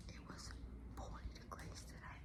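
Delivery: soft breathy whisper, no voice. Real, not acted. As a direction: whisper it to keep the house asleep. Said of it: "I have to whisper".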